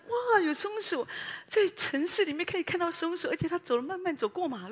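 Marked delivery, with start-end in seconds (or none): 0.0-1.0 higher, delighted squeal; light up at a squirrel; thrilled. Retold or imitated. Preacher spotting a squirrel, excited squeal.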